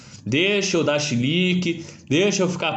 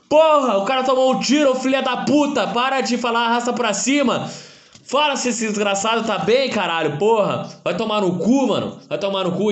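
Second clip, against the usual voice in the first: angry rant; 7 semitones higher than usual. Real, not acted. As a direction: scream at the influencer. Furious rant at clickbait. (gritando furioso)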